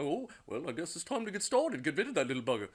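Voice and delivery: lightly voiced eccentric lady; a hint of the high-pitched clairvoyant; funny clairvoyant voice. Clairvoyant speaks again.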